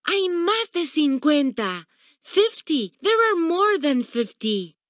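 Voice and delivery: put-on high-pitched, delighted children's cries; delighted howl. A narrator's reading. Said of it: "The children howled with delight".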